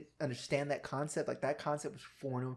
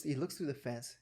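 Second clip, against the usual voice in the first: ordinary talk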